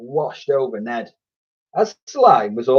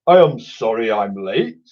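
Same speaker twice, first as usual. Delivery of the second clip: a hint of a gooey creature; calling out. A narrator's reading. Slime apologising for arriving late. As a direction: call it from far up. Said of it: "Slime called out".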